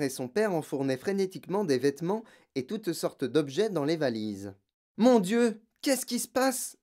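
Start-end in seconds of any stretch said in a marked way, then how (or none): 5.0-6.8 high, louder startled outcry; startled girl exclaiming; alarmed cry. A narrator's reading. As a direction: cry out in shock at what you see. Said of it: "s'écria-t-elle".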